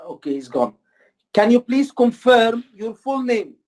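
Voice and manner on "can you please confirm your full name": slow, careful question to a patient; doctor asking a patient to confirm his name, slow and clear. Shown as a demonstration. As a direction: ask it slowly and clearly. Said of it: "it has to be slow".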